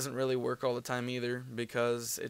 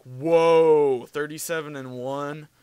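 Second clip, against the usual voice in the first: amazed whoa; 3 semitones higher, 12 dB louder, slower than usual. Retold or imitated. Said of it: "He was like".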